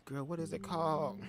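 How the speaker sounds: ordinary talk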